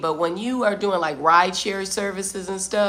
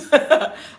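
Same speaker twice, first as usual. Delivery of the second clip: short breathy laugh; 8 semitones higher, 7 dB louder than usual. Real, not acted. Laughs at herself.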